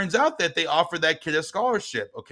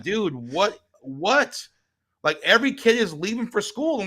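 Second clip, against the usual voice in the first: higher-pitched, incredulous appeal; exasperated plea; 8 semitones higher than usual. Retold or imitated. Dad questioning God about his son left at home, exasperated. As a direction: complain upward in rising disbelief. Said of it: "I'm, like, talking to God".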